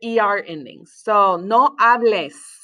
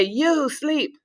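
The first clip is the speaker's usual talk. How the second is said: high, stretched YOU, then SLEEP as an order; command intonation; 4 semitones higher than usual. Shown as a demonstration. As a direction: demand it, leaning on YOU before SLEEP. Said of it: "demand someone that they sleep".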